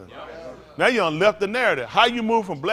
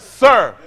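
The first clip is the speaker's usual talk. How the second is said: sir punched loud; stress on sir; 3 semitones higher, 12 dB louder than usual. Pretend. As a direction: hit the word sir hard and loud. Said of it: "Make sure I emphasize".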